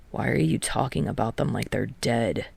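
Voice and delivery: low, bored drone; droning. A narrator's reading. Kei mocking Tadashi's talk of seniors, bored drone. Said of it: "Kei drones".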